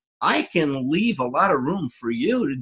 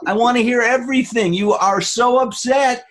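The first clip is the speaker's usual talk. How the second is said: mock encouraging; 10 semitones higher, 4 dB louder than usual. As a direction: urge them on, higher and unhurried. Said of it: "How often does somebody say".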